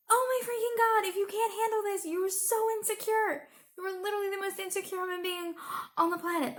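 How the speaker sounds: high-pitched, incredulous outburst; exasperated. Real, not acted. Ranting at an insecure Conway, exasperated. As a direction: let exasperation push the voice up high.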